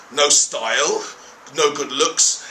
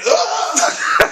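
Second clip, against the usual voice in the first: shrill startled cry, much higher; high yelp of shock; 5 dB louder than usual. Retold or imitated. Teller imitates the Dutch guy yelping when elbowed. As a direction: yelp as if knocked over. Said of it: "he just goes like".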